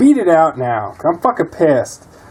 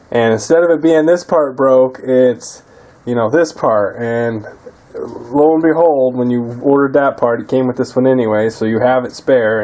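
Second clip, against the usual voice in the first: ordinary delivery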